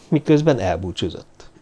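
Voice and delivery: ordinary delivery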